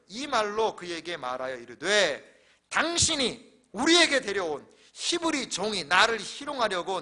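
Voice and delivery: loud, slow, declaimed verse; scripture reading. Acted. Pastor reading Joseph's false accusation to the congregation, loud solemn declamation. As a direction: proclaim each phrase with weight, stretched out.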